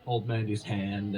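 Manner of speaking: normal talking voice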